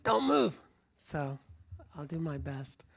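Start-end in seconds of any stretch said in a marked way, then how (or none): 0.0-0.6 stern order. Retold or imitated. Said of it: "but they said".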